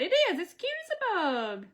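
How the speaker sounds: gleeful agreement. Real, not acted. Agreeing with a viewer comment.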